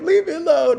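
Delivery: high, loud laughing whine; whiny refusal. Retold or imitated. Drunk to officer, high laughing whine. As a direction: whine back like a stubborn drunk. Drunk swatting broom away.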